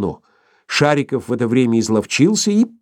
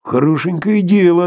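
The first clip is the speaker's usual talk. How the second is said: high, louder startled protest; frightened; 9 semitones higher, 5 dB louder than usual. A narrator's reading. Sharikov answers the demand for money, frightened. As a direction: protest in mild alarm, pitched up. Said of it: "испугавшись".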